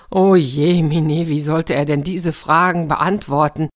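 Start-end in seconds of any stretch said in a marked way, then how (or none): 0.1-1.3 drawn-out, mournful wail, slow and a bit louder; slow lament. A narrator's reading. Narrator bemoans the servant's hopeless task, slow mock lament. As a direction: stretch it out like a theatrical moan.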